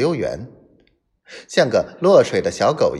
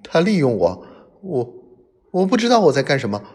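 fast, higher, stammering, trembling voice; shaking voice; 6 semitones higher, 4 dB louder, faster than usual. Acted. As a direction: stumble over the words in fear. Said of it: "他声音颤抖地说".

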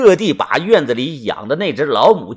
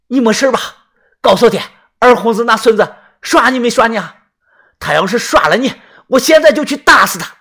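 high, raised, agitated voice; worried, angry father; 6 semitones higher, 5 dB louder, faster than usual. Acted. Father questions his daughter about the attacker, agitated and angry. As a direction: question urgently, building to a loud threat.

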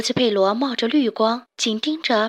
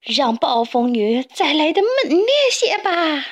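higher, louder defiant shout; wild shout at the storm; loud cry; 5 semitones higher, 4 dB louder than usual. Acted. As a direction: roar into the storm. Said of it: "佩罗大叫".

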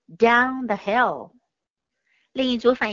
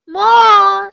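loud, high 'More'; eager shout; 9 semitones higher, 11 dB louder than usual. Acted. Jack begs for another ride, loud and high.

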